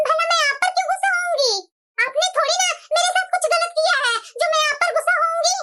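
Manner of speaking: ordinary talk, nothing marked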